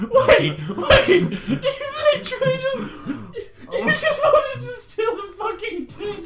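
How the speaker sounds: high squealing laughter; words breaking into laughter; laughing. Real, not acted. Cracking up at the story.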